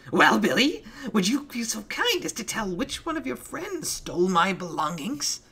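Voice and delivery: Penny shrieking at Billy from the balcony; banshee scream. Acted. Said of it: "Penny screamed like a banshee".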